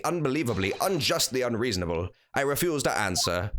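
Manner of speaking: lightly put-on voice of a huffy prisoner; mild theatrical outrage; indignant prisoner voice. Reads prisoner's lines in voice.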